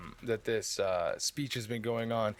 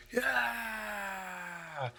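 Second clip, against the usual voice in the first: crowd cheer; 9 semitones higher than usual. Acted. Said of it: "you hear everybody go".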